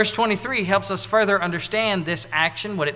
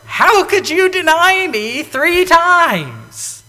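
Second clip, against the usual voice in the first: slow, high, loud reproach; mock rebuke of Peter; reproachful; 10 semitones higher, 9 dB louder, much slower than usual. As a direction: drag out the accusation in hurt anger. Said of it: "look at Peter and go".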